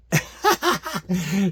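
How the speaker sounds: a short real laugh that rises. Real, not acted. Laughing about his brother's answer. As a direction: chuckle at the memory.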